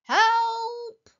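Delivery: loud, high, drawn-out cry; calling for help. A narrator's reading. A hidden voice calls to elephants, calling loudly for help. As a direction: shout it high and long. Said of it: "called the voice".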